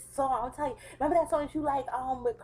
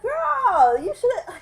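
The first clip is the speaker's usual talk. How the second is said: put-on much higher and louder, a gleeful girlfriend cry; excited squeal; 13 semitones higher, 11 dB louder, much slower than usual. Quoting her friend's verdict on the guy, loud excited squeal.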